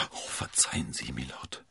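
soft, low, breathy apology; stammering. A narrator's reading. D'Artagnan begging Milord's pardon, stammering. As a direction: falter through an embarrassed apology. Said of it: "stammelte er".

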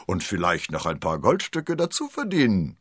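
ordinary delivery, nothing marked

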